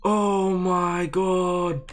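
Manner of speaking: very slow, high, loud wail; long-drawn cry of disbelief; dismayed wail. Real, not acted. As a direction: drag out every word in despair. Reacting to the blunder.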